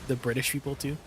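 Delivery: ordinary talk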